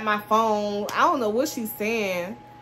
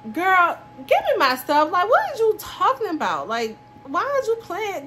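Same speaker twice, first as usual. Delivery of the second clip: retold yell; 9 semitones higher than usual. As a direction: yell it at her again in the retelling. Retold demand in a fight.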